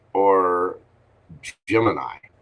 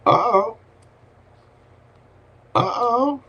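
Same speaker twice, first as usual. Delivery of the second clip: playful uh-oh. Acted. Devil card is drawn.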